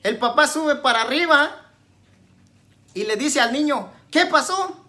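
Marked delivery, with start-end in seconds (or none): 4.1-4.8 acted alarmed father, high and louder; padre alarmado. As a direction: call out like a startled dad. Padre alarmado acude al grito. (imitando a un padre alarmado)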